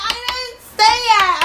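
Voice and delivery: high, slow x0.7 whiny repeat; mocking high denial. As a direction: drag out the fake denial, still high. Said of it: "She was like".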